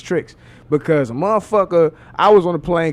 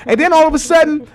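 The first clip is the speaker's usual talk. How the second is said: normal talking voice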